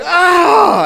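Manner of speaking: startled shriek of pain; pained yelp. Retold or imitated. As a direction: yelp like a hair just got ripped out. Wife yanks his nose hair.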